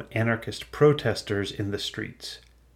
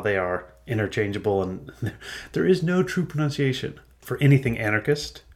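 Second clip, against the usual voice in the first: usual speaking voice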